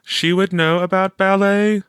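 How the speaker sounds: put-on a bright young girl asking; Teresa voiced. A narrator's reading.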